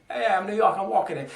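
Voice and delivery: a quick mock Bronx strut in the voice; hint of a New York tough guy; New York accent. Shown as a demonstration. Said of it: "like, Bronx style, like, you know, New York".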